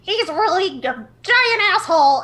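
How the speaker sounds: put-on high, loud, laid-back old trickster voice; Tishvia impression. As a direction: play him high and loud, shrugging it off. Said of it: "If I know Tishvia, he's gonna say".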